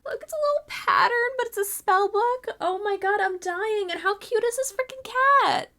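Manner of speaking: high, delighted squeal; freaking out. Real, not acted. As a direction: squeal over the cutest find, voice way up. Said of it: "totally freaking out".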